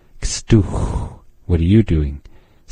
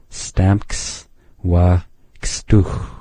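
Stamks wa kstuch said very slowly, syllable by syllable; teacher slowing right down; slow demo; much slower than usual. Shown as a demonstration. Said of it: "This kstuch can be a little tricky".

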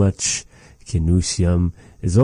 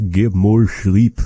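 mock advice; 2 semitones higher, 5 dB louder, slower than usual. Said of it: "No, just kidding".

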